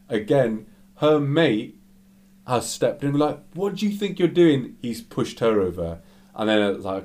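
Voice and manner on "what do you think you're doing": high-pitched mock confrontation; acted indignant challenge. Her mate steps in to confront him, acted indignation. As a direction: imitate someone stepping in outraged. Girl's friend steps in angrily.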